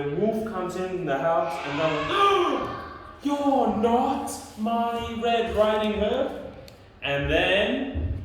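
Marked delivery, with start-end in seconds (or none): 2.0-3.2 high, loud mock gasp; shocked gasp. Grandma sees the wolf come in, high theatrical gasp. 3.2-6.4 alarmed Grandma. Acted. Grandma finds the wolf inside, high theatrical alarm.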